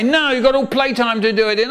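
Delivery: high, quick scolding refusal; strict teacher. Retold or imitated. Teacher turning down a whiny pupil, high brisk scolding. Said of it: "And she'd say".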